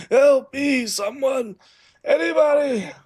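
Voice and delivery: high, desperate wounded man crying help; a dying man begging anyone for help; fluttering cries for help. Acted. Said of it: "and he's like".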